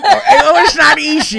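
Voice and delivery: loud, high, punchy; emphatic. Shown as a demonstration. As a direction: hit NOT hard. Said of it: "Say it again".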